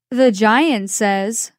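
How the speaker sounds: ordinary delivery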